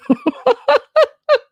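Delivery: sudden high squealing laugh; burst of laughter. Real, not acted. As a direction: explode into a real laugh. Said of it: "I have to laugh".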